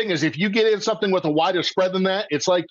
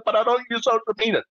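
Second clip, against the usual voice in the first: mock average person; 5 semitones higher, much faster than usual. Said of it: "the average person said".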